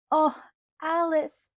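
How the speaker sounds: slow, drawn-out exasperated groan; groaning protest. A narrator's reading. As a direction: drag it out, worn down by Alice. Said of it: "I groaned".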